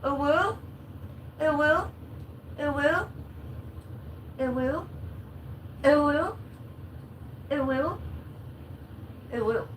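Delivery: high cooing uwu to a dog. Real, not acted.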